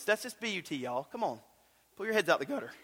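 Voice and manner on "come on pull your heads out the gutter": ordinary talk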